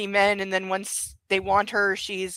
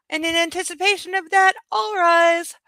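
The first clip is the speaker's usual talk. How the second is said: high, loud, stretched by a yawn; real yawn pushing the voice up high; talking through a yawn; 11 semitones higher, 6 dB louder than usual. Real, not acted. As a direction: yawn your way through the announcement. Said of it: "Sorry, I'm yawning".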